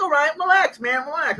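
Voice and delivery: high, lightly voiced placating guys; a hint of mocking opponents saying relax; mocking the other team. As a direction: make them sound whiny and placating. Imitating other team calming him.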